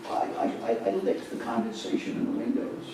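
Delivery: put-on faint, whispery old lady voice; a frail old lady croaking out her story; lightly voiced old woman, barely audible; old lady survivor.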